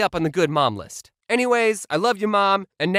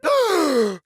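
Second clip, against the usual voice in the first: dramatic gasp; 10 semitones higher than usual. Retold or imitated. Reacting to mom's punishment.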